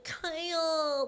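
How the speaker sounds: high whiny drawn-out call. Retold or imitated. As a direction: whine the name like a needy girlfriend. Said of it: "that whiny ass voice".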